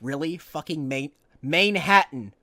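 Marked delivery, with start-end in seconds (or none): 1.4-2.3 louder annoyed outburst; frustrated shout. Real, not acted. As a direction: snap the word out. Reader fumbles the word.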